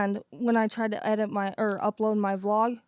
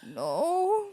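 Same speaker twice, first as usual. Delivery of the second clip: retold refusal; 7 semitones higher than usual. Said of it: "And you're like".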